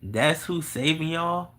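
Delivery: incredulous scoffing, voice jumping up on man, slowing on the next question; mocking disbelief. Imagined retort to believers, mocking disbelief. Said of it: "I'ma look at them motherfuckers like".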